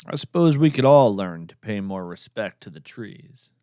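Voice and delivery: normal talking voice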